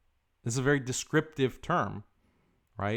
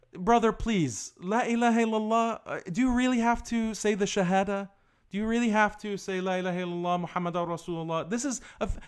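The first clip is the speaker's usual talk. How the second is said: high, whiny mocking plea, pitch raised; 7 semitones higher, 3 dB louder than usual. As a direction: mimic a preachy brother begging you to soften. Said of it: "they'll say".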